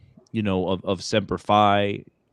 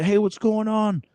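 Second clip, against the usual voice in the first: much higher, bright imitated hello from an old vet; old Marine's greeting; 11 semitones higher than usual. Old Marine at the gym greeting the speaker, imitated high and cheery. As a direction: mimic the old gym regular calling out hello. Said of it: "and he's like".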